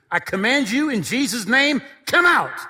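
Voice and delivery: loud, high exorcist's command, peaking at the end; commanding shout. Retold or imitated. Missionary commands a spirit out of witch doctor, forceful shout. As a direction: order the spirit out at full voice.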